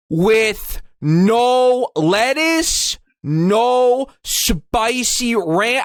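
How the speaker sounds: very slow, over-enunciated order with long stretched words; deliberate drive-thru ordering. Shown as a demonstration. Customer ordering at the drive-thru, painfully slow and deliberate. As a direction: draw out each word for someone who keeps mishearing. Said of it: "I'd have to order my order like this".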